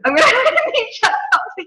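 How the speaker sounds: high, rushed words through giggles; talking while cracking up; real laughing. Real, not acted. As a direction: giggle your way through the sentence. Laughing at being stuck in top.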